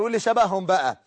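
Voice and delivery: usual speaking voice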